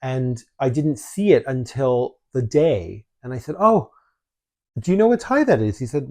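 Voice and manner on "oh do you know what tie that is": high, loud, fast surprised outburst; surprised exclamation. Retold or imitated. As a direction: retell the moment of shock with a jump up. Retelling surprise at grandfather's tie.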